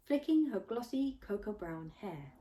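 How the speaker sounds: ordinary delivery, nothing marked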